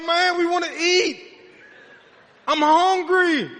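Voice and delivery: loud, high-pitched mock wail; impatient hungry complaint. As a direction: holler like a starving dinner guest. Voicing impatient guests during long prayer.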